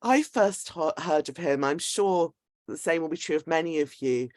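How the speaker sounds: ordinary talk, nothing marked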